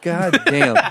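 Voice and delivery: high, groaning disbelief; he's there again, oh no; exasperated. Retold or imitated. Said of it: "And I was like".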